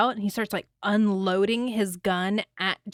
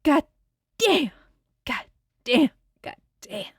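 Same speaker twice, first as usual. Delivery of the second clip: lightly voiced Mia Wallace; 5 semitones higher than usual. Quoting Mia's bathroom line from the film, a light impression. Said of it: "What does Mia say".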